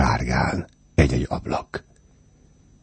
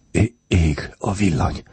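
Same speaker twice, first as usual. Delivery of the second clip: higher, startled stammer; 5 semitones higher than usual. A narrator's reading. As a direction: stumble on the first word in alarm. (riadtan dadogva)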